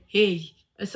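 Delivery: usual speaking voice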